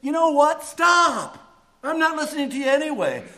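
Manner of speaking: loud, high, exasperated Stop; God cuts them off. Acted. God interrupting long-winded prayers, a loud exasperated shout. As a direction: slam the door on the prayer. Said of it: "And God says".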